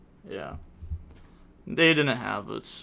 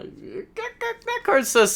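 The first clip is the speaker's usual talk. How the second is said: squeaky outrage; slower than usual. Real, not acted. Streamer outraged by card text.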